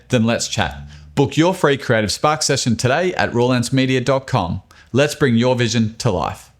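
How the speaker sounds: loud, upbeat sales pitch; ad read. Acted. Host reading his sponsor ad to listeners, punchy and loud. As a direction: sell it like a radio spot. Inserted ad read for Raw Lens.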